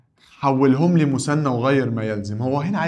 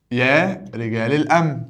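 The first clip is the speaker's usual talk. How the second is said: يا رجال الأمن said slowly and level, each word spaced out; slow it down so students hear each word of يا رجال الأمن; slow repeat. Shown as a demonstration. Teacher repeats the vocative slowly for students to parse. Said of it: "ده منادى".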